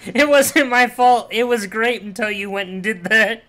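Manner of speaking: high, shouted blame-shifting; loud, high-pitched playful outburst; protesting loudly. Real, not acted. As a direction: yell your excuse at a teammate. Loud mock blame after mishap.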